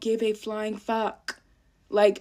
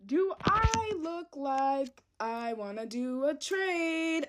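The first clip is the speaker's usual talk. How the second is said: very slow, softer, flat incredulous question; deadpan disbelief; 4 semitones higher, 6 dB quieter, much slower than usual. Real, not acted. To the viewers about mom's trade idea, slow deadpan disbelief. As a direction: let the absurdity hang, slowly and quietly.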